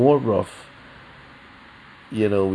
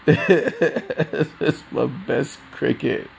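slowed down, laughing through it; 6 semitones higher, much slower than usual. Real, not acted. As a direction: laugh while saying it, higher.

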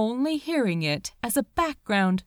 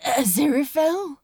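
unsteady call; 4 semitones higher, 4 dB louder, faster than usual. A narrator's reading. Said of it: "His voice was unsteady".

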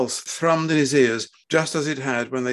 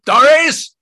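very loud, high yell; furious bellow at his wife; whip-crack shout; 13 dB louder than usual. Acted. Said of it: "His voice was like the crack of a whip".

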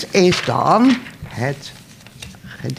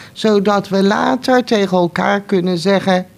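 usual speaking voice

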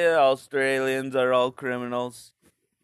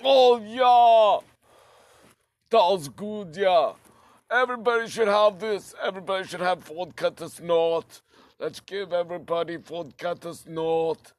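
put-on a drunk Russian boasting about vodka; Russian accent; 4 semitones higher than usual. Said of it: "the vodka snorting Russians".

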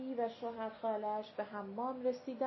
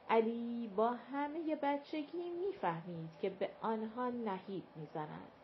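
ordinary delivery, nothing marked

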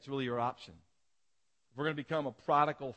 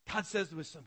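normal talking voice

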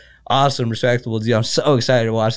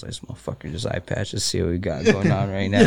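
hushed aside; 4 semitones lower, 6 dB quieter than usual. Retold or imitated. Sizing up a rival cornerman.

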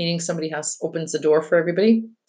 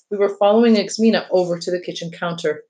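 usual speaking voice

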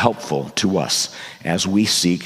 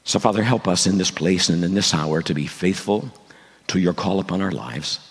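prayer. Acted. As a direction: pray earnestly to God. Pastor leads a prayer.

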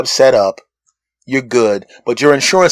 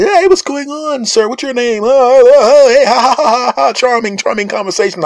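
much higher, gushing over-friendly greeting with fake laughs; fawning shop staff; 11 semitones higher, 4 dB louder than usual. Staff fawning over a well-dressed customer, high and gushing. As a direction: ham up the sucking-up staff. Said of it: "and everybody".

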